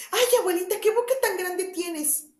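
put-on the girl starts to sense danger; alarmed little girl. Caperucita asks her grandmother, high and alarmed.

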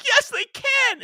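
very high, loud mock shriek; hammed-up imitation of a scared daughter; panicked retort. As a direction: scream it back like a terrified child. Imitating scared daughter contradicting father.

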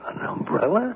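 can't believe it's an umbrella; incredulous. Acted. Man doubting the umbrella plan, incredulous high question.

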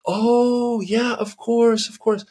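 high, loud "oh" then slow "of course"; light up as it finally clicks; woman who finally understands, high and bright with relief; sudden realization. Retold or imitated. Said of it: "And she goes".

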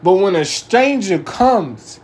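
loud, driving sermon voice; fiery preaching. Acted. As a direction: preach it hard to the whole church. Full-force preaching to congregation.

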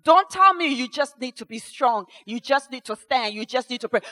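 ordinary talk, nothing marked

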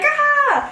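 a short high yelp of alarm; retold scream. Friend spilling wine, imitated with a high shriek. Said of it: "she screams at the end".

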